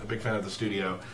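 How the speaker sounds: usual speaking voice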